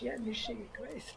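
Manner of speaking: softly whispered Yiddish word; whispered aside. Real, not acted. Explaining Yiddish to the listener in a whisper.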